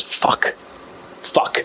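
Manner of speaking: hushed, defeated muttered curse; dejected cursing. Retold or imitated. Boy seeing his rival arrive, retold dejected muttering. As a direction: swear softly under your breath, imitating dejection.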